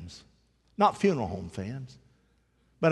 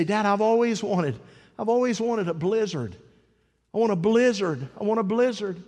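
put-on higher, eager kid wanting a blizzard; boy pleading; 6 semitones higher, 3 dB louder than usual. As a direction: beg like a child who wants snow. Boy begging dad for a blizzard.

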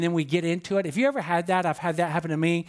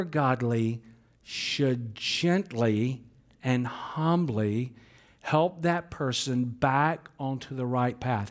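very slow, dwelling on "humbly"; slow, emphatic reading; 4 semitones lower, much slower than usual. Shown as a demonstration. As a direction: draw out "gently and humbly". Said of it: "listen to this now".

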